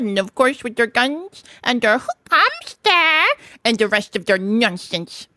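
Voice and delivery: little Mole spinning a proud tale; pleased Mole. A narrator's reading. Mole recounting his disguise, pleased. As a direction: relish the telling in a put-on character voice.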